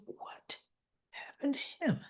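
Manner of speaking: near-whispered, stunned question; hushed shock. Retold or imitated. Doctor seeing the injured boy, hushed shock. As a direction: breathe it out very softly.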